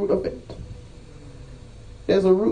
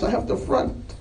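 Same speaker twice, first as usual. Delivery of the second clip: normal talking voice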